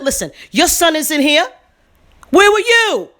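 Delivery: scolding a mother who lost her son; angry confrontation. Retold or imitated. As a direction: demand an answer from her.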